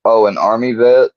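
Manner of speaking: normal talking voice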